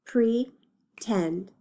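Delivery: broken into syllables. Shown as a demonstration. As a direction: PRETEND split in two, pre, then tend. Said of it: "decide how many syllables it has".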